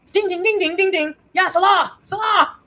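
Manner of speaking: high chiming ding ding; sing-song doorbell. Retold or imitated. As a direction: ring it out high like a bell. Imitating doorbell and calling out.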